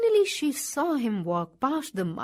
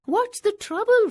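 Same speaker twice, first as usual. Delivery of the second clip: higher, louder kangaroo voice calling gently; kind mother kangaroo; 10 semitones higher, 6 dB louder than usual. A narrator's reading. Kangaroo greets the old wombat, higher and louder. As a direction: call out kindly to a stranger.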